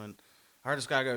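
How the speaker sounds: usual speaking voice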